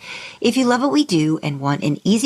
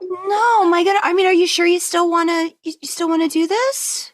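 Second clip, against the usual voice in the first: alarmed concern; 11 semitones higher than usual. Real, not acted. Host asks the guest in the ICU if she still wants to go on, worried and high. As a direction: pitch up, pressing with worry.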